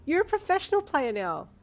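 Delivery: high, loud proud gush. A narrator's reading. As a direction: praise him proudly. Proud girlfriend.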